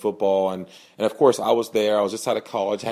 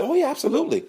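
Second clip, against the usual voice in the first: much higher, upbeat yes; eager reassurance; faster than usual. Retold or imitated. Man assures the boy he'll still play catch, upbeat and high. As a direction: reassure him with a lift.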